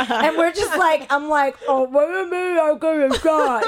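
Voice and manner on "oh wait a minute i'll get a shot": high, loud, garbled mouthful voice; imitating muffled speech with a full mouth; talking with mouth full. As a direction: act out talking round a mouthful, loudly. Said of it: "dick in my mouth".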